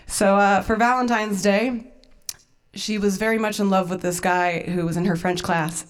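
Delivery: normal talking voice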